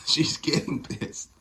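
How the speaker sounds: ordinary delivery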